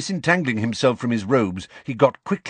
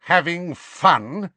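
slow, raised, menacing question; 8 semitones higher, 3 dB louder, slower than usual. A narrator's reading. Adult Snape catches Harry snooping, enraged. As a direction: stretch out the two words in icy rage.

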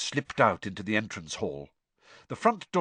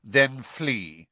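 curt; 2 semitones higher, 4 dB louder than usual. Acted. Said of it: "said Snape's voice curtly".

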